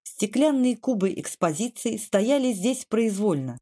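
plain reading voice

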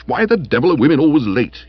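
irritable, exasperated complaint; peevish. Acted. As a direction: fret crossly about being kept waiting. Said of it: "he said peevishly".

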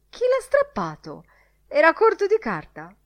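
loud, very high-pitched questioning; indignant demand. Acted. Editor demands who tore it.

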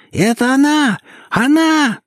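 high hysterical shriek. Acted. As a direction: scream it, way up high. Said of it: "визжала она".

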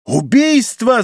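high alarmed shout. A narrator's reading. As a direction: yell the alarm. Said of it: "закричал Патрик".

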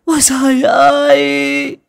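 very high, loud, drawn-out wailing; stretched, keening cries at full voice; shrieking lament. Acted. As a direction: cry out to heaven in hammed-up grief. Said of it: "Mụ Vũ quỳ xuống lại chu chéo".

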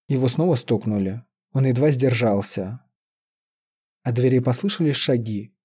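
usual speaking voice

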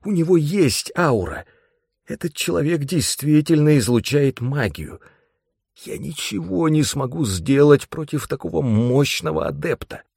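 high-pitched, alarmed voice; gasping alarm. A narrator's reading. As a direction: blurt it out, shaken by the discovery. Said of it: "ахнул я".

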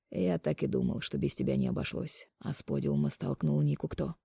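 soft, restrained questioning; disappointed Mark, quiet. A narrator's reading. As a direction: press her quietly, without force. Narrator voices Mark in dialogue. (тихо и сдержанно, с разочарованием)

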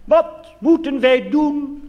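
very high, slow pulpit boom; mock the minister's sermon; hammed-up preaching; preacher's echo. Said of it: "dan zei hij van".

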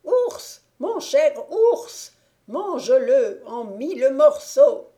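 shrieking high, loud witch call, slow start; witch screaming. A narrator's reading. Witch calling her bear to tear the prince apart: high loud shriek. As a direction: scream to the bear from far away. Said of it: "la voix de la sorcière qui criait".

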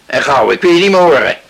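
luid en snel afgesnauwd; boos bevel. Acted. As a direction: snauwt het kind naar bed. Vader stuurt kind weg. (strenge vader snauwt dochter luid naar bed)

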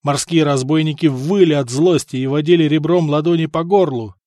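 ordinary delivery

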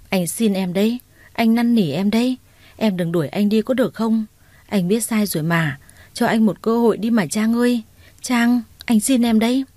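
ordinary delivery, nothing marked

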